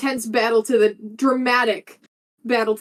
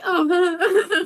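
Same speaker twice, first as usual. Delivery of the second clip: laughing oh; 6 semitones higher than usual. Retold or imitated. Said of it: "I was like".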